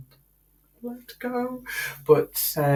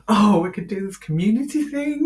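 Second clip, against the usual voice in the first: higher, louder mock enthusiasm; carried away; 5 semitones higher, 6 dB louder than usual. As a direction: imitate yourself getting carried away with an idea. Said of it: "I get carried away with ideas".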